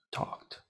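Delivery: "Talked" very softly, ending "kt"; voiceless ending. Shown as a demonstration. Teacher repeating the answer, soft voiceless T ending. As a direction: say "Talked" quietly with a crisp T. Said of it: "no tiene vibración".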